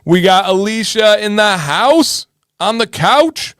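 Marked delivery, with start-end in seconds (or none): none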